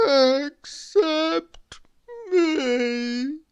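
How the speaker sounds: high, drawn-out tearful moan; hammed-up melancholy voice; mournful sigh. A narrator's reading. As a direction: wail it sadly, on the edge of crying. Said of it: "sighed deeply".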